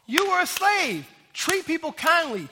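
high, loud "hold on" easing into firm admonishment; emphatic rebuke. Acted. Preacher as God interrupting boastful former slaves, high emphatic rebuke. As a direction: cut in sharply, acting out a stern correction.

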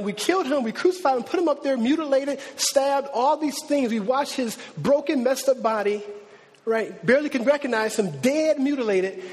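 building preaching. Acted. Pastor pressing the crucifixion on the congregation, mounting preaching. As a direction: drive each phrase harder as the list grows.

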